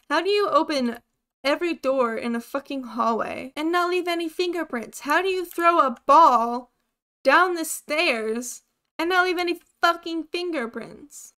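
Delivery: exasperated, loud rising rant; getting mad. Real, not acted. Player ranting at a ghost over missing fingerprints, exasperated. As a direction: demand answers, voice climbing in frustration. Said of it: "I'm getting mad".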